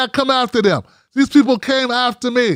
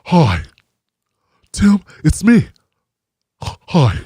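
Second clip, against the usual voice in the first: lower, fast, with breathy gasps; Cory Booker impression; 5 semitones lower than usual. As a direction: gush like an eager politician. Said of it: "[name], that's how you sounded".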